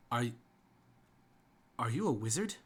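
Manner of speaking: halting, stammered question; start, stop, then ask tentatively; hesitant young guardsman; stuttering. Pretend. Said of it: "if you'd stop stuttering".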